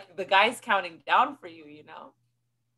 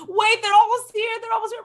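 urgent call; 13 semitones higher, 4 dB louder, faster than usual. Retold or imitated. Friends holding plane, rushing.

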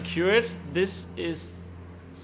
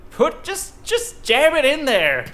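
exasperated; 9 semitones higher, 4 dB louder than usual. Real, not acted. Frustrated at the game.

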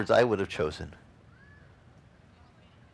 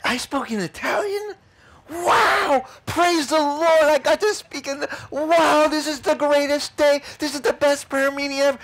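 much higher, louder, ecstatic squeals; flipping out; 13 semitones higher, 4 dB louder than usual. Retold or imitated. The praying lady discovering she spoke Italian, ecstatic squealing. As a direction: gush like the happiest day ever. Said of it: "She's flipping out".

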